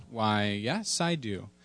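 low, soft father voice; cartoon dad. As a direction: answer as a subdued cartoon daddy. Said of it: "Daddy, do you believe".

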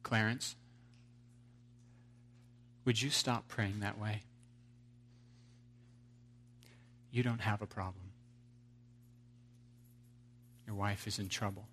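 quiet and patient, speaking as God; gentle voice of God. Acted.

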